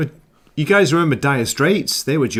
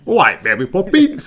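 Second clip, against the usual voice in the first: higher, louder mock Cockney accent; 7 semitones higher, 6 dB louder than usual. Mocking the classic film Cockney for friends, hammed-up Cockney accent. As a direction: ham up a stagey film Cockney.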